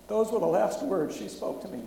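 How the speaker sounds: pushing through grief while reading aloud; emotional story reading. Real, not acted. Preacher reads a bombing survivor's account to the congregation, loud and strained. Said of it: "read it a little bit woodenly".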